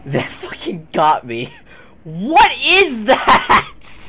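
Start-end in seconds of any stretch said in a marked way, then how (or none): 2.0-4.1 high startled laughing shriek; shocked laugh. Real, not acted. Horror game scare, laughing shriek.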